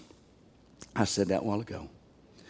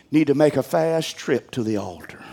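usual speaking voice

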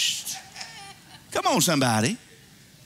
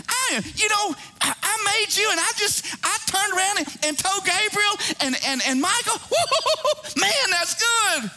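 higher, tumbling excitement with a whooping laugh; God bragging, delighted; 5 semitones higher than usual. Acted. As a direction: play a Creator showing off his work to friends. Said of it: "God's conceited for you".

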